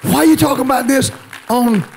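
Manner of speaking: high, loud pulpit cry building to a stretched final word; full-force preaching. Real, not acted. Preacher proclaiming Christ's birth before Christmas, full-force preaching.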